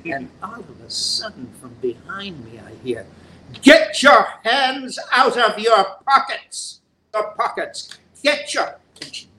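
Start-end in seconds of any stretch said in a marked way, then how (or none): 3.6-8.8 much higher, loud, slow indignant command; an imperious grande dame snapping at him; scolding old actress. Retold or imitated. Helen Hayes scolds the young actor mid-performance, high and loud.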